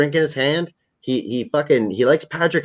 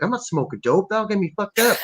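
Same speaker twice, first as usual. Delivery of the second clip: lightly acted higher-pitched refusal; mock refusal; 9 semitones higher, 3 dB quieter than usual. Fan quoting an actor turning down dope, higher mock voice. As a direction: push the pitch up as the refusal goes on. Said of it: "but Ricky is like".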